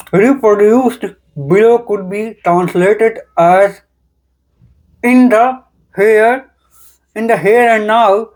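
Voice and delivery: reading with a pen clamped in teeth; slow, careful reading around a pen. Shown as a demonstration.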